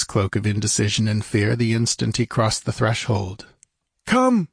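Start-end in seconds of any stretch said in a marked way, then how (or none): 4.0-4.5 high-pitched urging call. A narrator's reading. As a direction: urge your friends along in a high voice. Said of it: "he urged his newfound friends".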